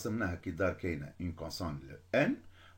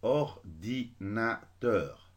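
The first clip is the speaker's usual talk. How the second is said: "Ordinateur" cut into or-di-na-teur, slowly; robot syllable split; much slower than usual. Shown as a demonstration. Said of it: "كشغل لو روبو". (« Ordinateur » découpé lentement, comme un robot)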